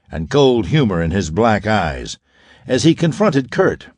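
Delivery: ordinary delivery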